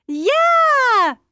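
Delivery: very high, loud happy cry; young mouse accepting with glee; joyful yes. Acted. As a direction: burst out with delight. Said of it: "zei verheugd".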